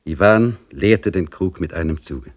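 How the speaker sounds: ordinary delivery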